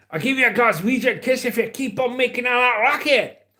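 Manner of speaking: put-on high, threatening Scottish accent; Scottish stepdad. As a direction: imitate a scolding Scottish stepdad. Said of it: "a Scottish stepdad".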